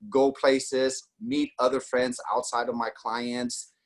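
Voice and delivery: ordinary talk, nothing marked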